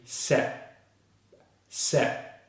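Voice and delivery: "set" cut off with the stop unreleased; unreleased final stop. Shown as a demonstration. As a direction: say "set" without releasing the last consonant. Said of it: "we release on the T in the next syllable".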